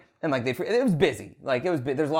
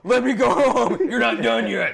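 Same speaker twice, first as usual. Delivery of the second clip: laughing mock plea; 10 semitones higher, 6 dB louder, slower than usual. Imitating begging in Vegas.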